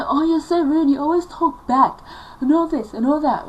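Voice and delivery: high, loud imitation of a telling-off; mock scolding mother. Mother accuses daughter of talking back, mock scolding.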